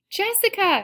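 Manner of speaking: excited, high-pitched shout of greeting; calling out. A narrator's reading. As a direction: call a friend's name through a door. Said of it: "she calls through the screen door".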